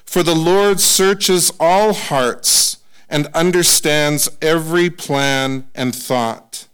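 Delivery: slow, solemn reading of a father's charge; scripture reading. Acted. Preacher reading David's charge to his son, solemn and slow. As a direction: read it weightily, like a blessing.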